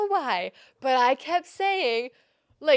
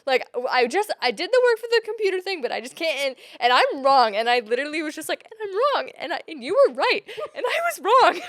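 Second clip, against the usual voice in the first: higher, louder, tearful rant; mock crying rant; 4 semitones higher, 6 dB louder than usual. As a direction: blurt it out through tears. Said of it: "crying because I'm like".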